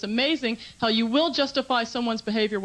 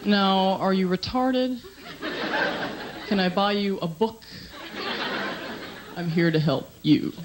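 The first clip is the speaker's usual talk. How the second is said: mock condescending; 4 semitones lower, slower than usual. Woman mocking husband's complaint.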